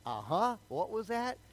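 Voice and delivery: can't believe what you just heard; incredulous. Retold or imitated.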